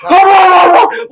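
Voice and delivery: very high, loud shrieking yell; mock barking yell. Mimicking the red-faced driver yelling out his window, loud high shriek. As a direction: imitate a mad barking dog.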